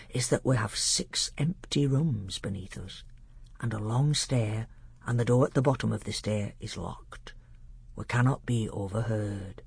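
low, hushed learned doctor; grave tutor sharing a secret; secretive old tutor. Acted. Narrator voices the doctor.

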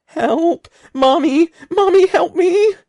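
very high, loud sobbing cries; tearful screaming plea; crying for help. A narrator's reading. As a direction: wail for mommy like a terrified child. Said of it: "He started to cry".

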